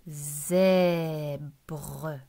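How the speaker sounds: "Zèbre" low and stretched into two beats; drawn-out syllables. Shown as a demonstration. Teacher showing the two syllables to a pupil, "Zèbre" drawn out. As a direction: sound out "Zèbre" slowly as zè, breu. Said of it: "il y a deux syllabes".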